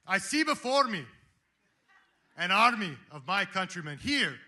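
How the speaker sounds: put-on loud, high rallying speech in a Scottish accent; rally the army as a Scottish warlord; Scottish accent, Braveheart. Speaker quotes Wallace to his ragtag army, rousing Scottish battle cry. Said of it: "should I do it in a Sc-, Scottish accent".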